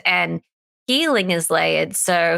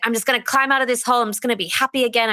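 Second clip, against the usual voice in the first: quick, higher mock cheer; mock upbeat resolve; 5 semitones higher, much faster than usual. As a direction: rattle it off like forced optimism.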